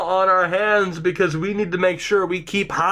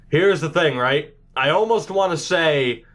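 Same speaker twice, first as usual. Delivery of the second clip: ordinary delivery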